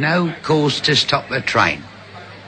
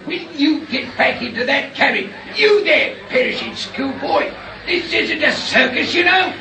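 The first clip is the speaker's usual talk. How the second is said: guard shouting; 10 semitones higher than usual. Acted. Railway guard shouting at passengers who slip away. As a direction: bellow across the track at the runaways.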